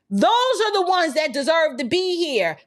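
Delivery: normal talking voice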